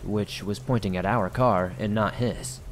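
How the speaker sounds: ordinary delivery, nothing marked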